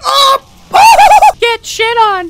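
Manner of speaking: very high, loud whoop into a laugh; real euphoric taunt; gloating scream. Real, not acted. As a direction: crow over the kill. Big kill, gloating.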